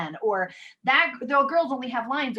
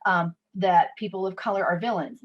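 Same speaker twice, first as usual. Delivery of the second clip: ordinary talk, nothing marked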